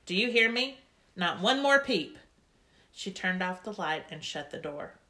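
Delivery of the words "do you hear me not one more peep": louder grouchy warning, a bit higher. A narrator's reading. Grandma scolding the girls at bedtime: grouchy, loud warning. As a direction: snap it at the noisy girls like a cross grandma. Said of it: "very grouchy".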